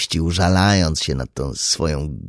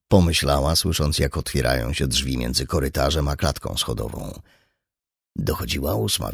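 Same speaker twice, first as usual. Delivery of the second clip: usual speaking voice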